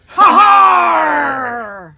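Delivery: loud, long drawn-out "ha-harr"; pirate laugh. Acted. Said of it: "the spirit of Talk Like a Pirate Day".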